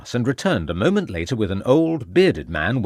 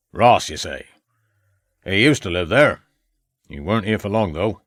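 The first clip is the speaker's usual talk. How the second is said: put-on rustic old countryman recalling a neighbour; old farmer. A narrator's reading.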